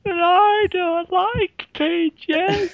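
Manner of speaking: put-on high, loud, drawn-out kid complaint; hammed-up childish protest; whining child. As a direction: stretch it out in a squeaky child voice. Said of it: "the child in the aeroplane with the Capri Sun".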